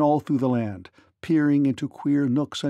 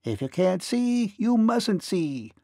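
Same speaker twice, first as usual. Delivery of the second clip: high little man's voice, drawn out at the end; lightly amused, higher-pitched character voice; amused tiny ferryman; 6 semitones higher, slower than usual. A narrator's reading. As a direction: answer teasingly as a tiny man. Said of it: "he answered with a laugh".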